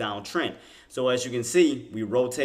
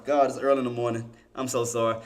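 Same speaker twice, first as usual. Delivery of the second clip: normal talking voice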